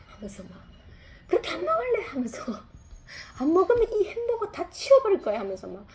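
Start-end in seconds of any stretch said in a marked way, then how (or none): 1.3-2.0 much higher, louder hammed-up threat; mock angry shout. Parent reenacting yelling at child over burger, hammed-up angry shout. 3.4-5.4 much higher, louder hammed-up threat; mock angry shout. Parent reenacting yelling at child over burger, hammed-up angry shout.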